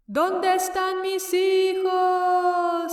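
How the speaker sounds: high, slow wailing ghost; weeping ghost woman. Acted. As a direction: wail for your lost children. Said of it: "if you hear her call".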